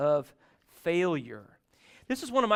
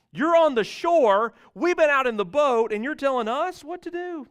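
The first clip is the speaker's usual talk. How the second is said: mock indignant; 8 semitones higher, 7 dB louder than usual. Disciples scoffing at the man on the shore, loud disbelief. Disciples mocking Jesus' advice.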